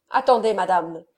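firm, mildly angry command; angry interruption. A narrator's reading. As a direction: cut in with contained anger. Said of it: "dit le comte rougissant de colère". (colère contenue, ton impérieux)

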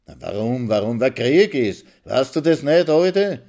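rising scornful jeer; mocking. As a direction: taunt her, building up. Maxl mocks the old woman.

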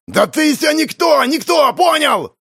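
high furious yell; shouting. A narrator's reading. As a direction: yell it out in rage. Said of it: "заорал молодчик".

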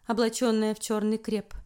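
usual speaking voice

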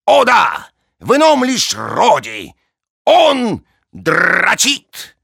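high, loud, drawn-out gleeful cry; excited exclamation. Acted. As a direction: blurt it out with glee. Pedrilo exclaims.

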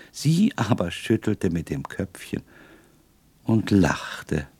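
plain reading voice

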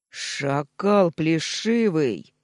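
slow, loud roar of insult; furious roar. A narrator's reading. Nephew enraged at insult to his mother, furious roar. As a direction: roar it out, drawing out each word. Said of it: "взревел я".

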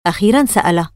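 ordinary delivery

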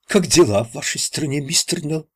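put-on lightly Irish-accented cheeky question. A narrator's reading. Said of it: "выпалил он с ирландским акцентом".

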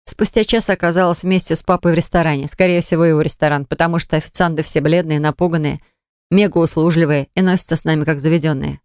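usual speaking voice

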